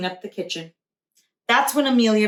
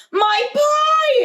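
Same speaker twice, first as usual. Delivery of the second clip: high, loud shocked cry; alarmed cry; 5 dB louder than usual. A narrator's reading. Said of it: "exclaimed Grandma".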